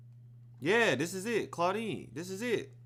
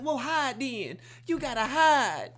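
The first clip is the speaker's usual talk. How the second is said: louder, slow insistent order; exasperated mother; 7 semitones higher, 7 dB louder, slower than usual. Retold or imitated. Mother telling the boyfriend to hide, slow and loud. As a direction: drag it out, insisting. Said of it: "And she like".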